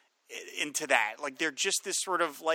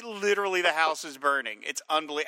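usual speaking voice

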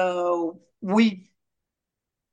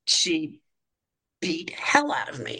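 whispered, the breath dropping away; a quiet, heavy aside about mom; hushed whisper; 5 dB quieter than usual. Real, not acted. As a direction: let it fall to a bare whisper. Repeats the beating line, hushed.

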